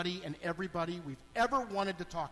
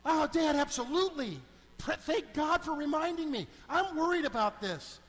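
eager son; 6 semitones higher than usual. Retold or imitated. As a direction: gush agreement to Dad. Son eagerly agreeing on phone.

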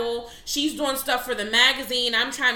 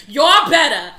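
loud, hammed-up New York accent; 11 dB louder than usual. Shown as a demonstration. Host rereads a listener's comment for laughs, loud New York accent. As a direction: belt it out like a brash New Yorker. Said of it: "I gotta say that with a New York accent".

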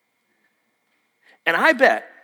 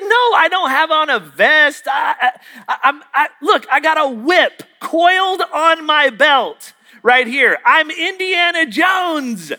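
high, loud, drawn-out indignation; hammed-up frustrated shouting at not being recognised; exasperated outburst; 7 semitones higher, 4 dB louder than usual. Retold outburst at third guess.

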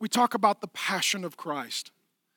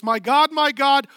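anguished cry, much louder; 5 semitones higher, 6 dB louder than usual. Acted. Jesus crying out to the Father on the cross, loud anguish. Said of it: "Jesus cried with a loud voice".

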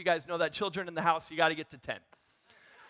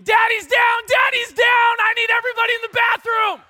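much higher, louder urgent shout; retold nurse scream; 16 dB louder than usual. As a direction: scream for help like a panicked nurse. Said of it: "holding my head up screaming".

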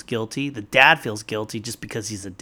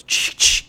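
voiceless imitated shotgun racking, ch ch; click-clack a pump shotgun with your mouth; shotgun pump noise; 8 dB louder than usual. Retelling the mom cocking her shotgun at the aliens, imitated pump click. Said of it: "the universal "shut your fucking mouth" noise".

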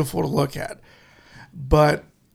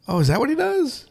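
much higher, playing dumb; mock innocent; 8 semitones higher, 4 dB quieter than usual. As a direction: ask it like you've never heard of him. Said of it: "And I'm like".

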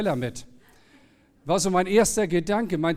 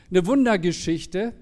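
ordinary delivery

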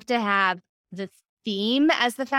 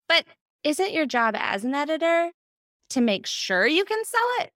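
higher, questioning lilt; pointed question; 7 semitones higher than usual. Retold or imitated. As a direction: imitate a husband gently challenging, pitch climbing. Quotes husband's gentle challenging question.

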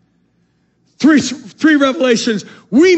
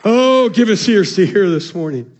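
normal talking voice

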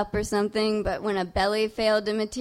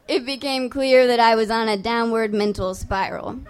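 normal talking voice

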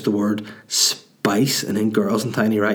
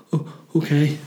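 mildly acted nervous reply, higher; mock timid; 6 semitones higher than usual. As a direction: meekly give in, half-acted fear.

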